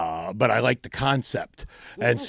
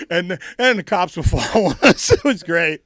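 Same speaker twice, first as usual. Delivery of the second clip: ordinary talk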